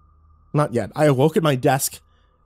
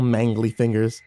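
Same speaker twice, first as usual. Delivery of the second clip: usual speaking voice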